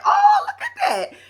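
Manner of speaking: squealed high, laughing through it; high giggly squeal of delight; delighted gasp. Retold or imitated. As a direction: act amazed at the pretty wedding. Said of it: "I was like".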